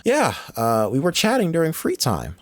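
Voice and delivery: put-on high, timid young girl; girl's voice. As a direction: answer like a nervous schoolgirl.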